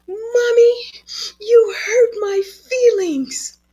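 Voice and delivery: put-on high, slow, tearful little girl; crying child. Mother voicing daughter telling mommy she's hurt. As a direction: sob it out like a hurt seven-year-old.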